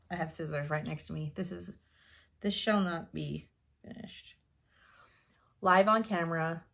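normal talking voice

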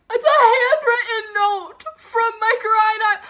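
high, loud, near-tearful squeal of joy; overjoyed squeal. Real, not acted. Fan squeals at a handwritten note from her band. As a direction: burst out with teary delight.

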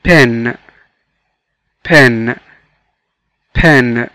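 PEN said alone, clearly, with falling pitch; model pronunciation. Shown as a demonstration. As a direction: model "pen" for learners to repeat. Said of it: "قولوا معايا". (نطق تعليمي واضح لكلمة pen)

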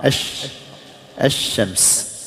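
«الشمس» broken after a long ash, then ash-shams; silent lam. Shown as a demonstration. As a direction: show the doubled sh of «الشمس», no l. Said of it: "اللام ما تنطق". («الشمس» بشين مشددة ولام لا تنطق)

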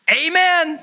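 high, exclaimed approval; enthusiastic amen. Retold or imitated. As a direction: cry it out as a hearty endorsement. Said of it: "I said".

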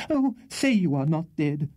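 high, overwrought plea; hysterical pleading. A narrator's reading. Hysterical lady to wounded Tupman, pleading. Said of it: "ejaculated the hysterical lady".

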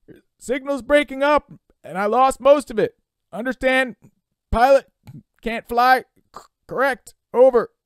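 choppy, loud, high operator voice; broken-up radio call with gaps between words; radio signal breaking up. Acted. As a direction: transmit in fragments through static. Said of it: "Brian could barely hear him now".

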